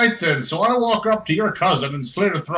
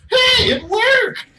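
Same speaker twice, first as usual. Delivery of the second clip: very high, loud, stretched-out cheer; squealed, drawn-out celebration; gleeful shout; 7 dB louder, much slower than usual. Pretend. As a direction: shout it high in mock triumph. Game master exclaiming amid laughter.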